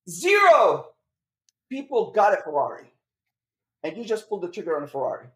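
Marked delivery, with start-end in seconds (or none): 0.1-0.9 Zero punched high and loud; stress on zero. Real, not acted. Host landing the punchline that nobody else bought a Ferrari, stressed. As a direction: hit Zero hard after a pause. Punchline about coaching students.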